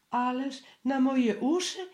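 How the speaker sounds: high flustered stammer from a scared piglet. A narrator's reading. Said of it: "wyjąkał znowu".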